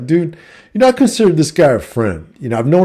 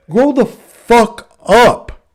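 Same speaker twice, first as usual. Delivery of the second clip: slow, drawn-out, higher and louder; exasperated outburst; 10 semitones higher, 4 dB louder, much slower than usual. Retold or imitated. Telling his old friend off, fed up and exasperated. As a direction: stretch every word like you've had enough.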